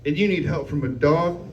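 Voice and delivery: usual speaking voice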